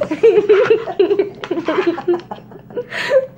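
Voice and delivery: long peal of laughter; real laughter. Real, not acted. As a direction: keep laughing helplessly for several seconds. Laughing at the feeding mishaps.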